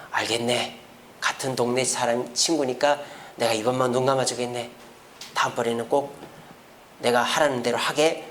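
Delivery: a hint of a kindly collector letting it slide; lenient tax collector. Tax collector excusing a neighbor, soft and indulgent. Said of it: "이렇게 하는 사람이 아니에요".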